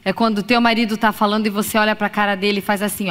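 normal talking voice

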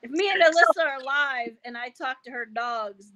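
ordinary talk

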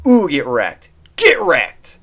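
playful trash-talk shout; gloating taunt. Pretend. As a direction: yell it like a hyped-up heckler. Crowing at the insulted Alice.

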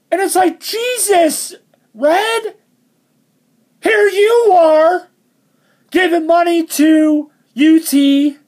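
high, disbelieving outburst; exasperated. Real, not acted. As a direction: throw your hands up in disbelief. Exasperated rant at Red.

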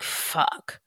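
breathless whispered fuck; a quiet, voiceless curse of regret. Retold or imitated. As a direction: hiss it under your breath in dismay. Realizing she killed a kind woman.

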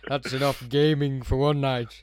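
a touch of a put-on role, drawn out; Tom Baker. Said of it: "Tom Baker puts the controller down and says".

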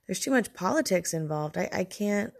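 ordinary talk

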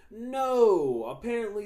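high, loud exclaimed denial; loud comic outburst of exasperation; emphatic correction. Real, not acted. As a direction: burst out high to overturn the assumption. Contradicts his own earlier assumption.

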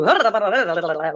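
rapid rolling babble imitating Gaelic; mock-Gaelic gabble with a laugh; tumbling nonsense syllables, laughing; imitated tongue-twisting gibberish. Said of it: "like that".

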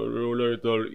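mumbled, thick put-on male greeting; thick-voiced boy. Coach imitating boys whose voice has broken, thick mumbled greeting. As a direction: swallow the words in a thick voice. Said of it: "se les vuelve gruesa y yo".

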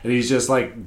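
usual speaking voice

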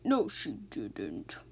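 whispered coo. Acted. Officer reassures the old car Petunia, in a low slow whisper. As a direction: murmur it tenderly to the car.

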